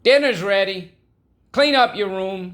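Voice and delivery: high, loud call from another room; bellowing mom. Retold or imitated. Mom calling the kids from the other room, bellowing. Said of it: "She bellows to you".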